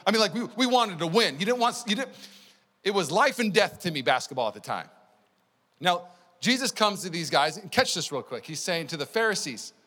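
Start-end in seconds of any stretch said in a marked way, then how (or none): none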